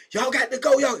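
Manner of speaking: high, loud order; loud high command; officer hollering. Retold or imitated. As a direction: holler it at the visitors. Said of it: "why they hollering".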